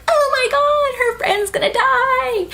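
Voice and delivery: high, wailing mock panic; horrified reaction. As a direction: squeal it in dismay. Retelling own horrified reaction.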